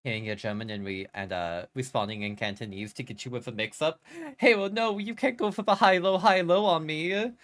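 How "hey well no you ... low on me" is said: mock protest.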